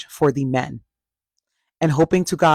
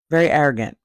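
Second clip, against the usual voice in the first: ordinary delivery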